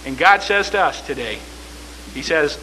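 ordinary talk

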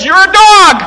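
loud, high cutting words; Jesus rebuffing the mother loudly; sharp rebuke. Acted. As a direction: fling the insult at her.

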